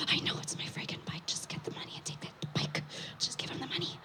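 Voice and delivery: hushed frantic whisper; whispering urgently. Retold or imitated. On the phone at two A M to the shop owner, urgent whisper. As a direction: push the words out without voice, desperate. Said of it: "whispering on the phone at two A M".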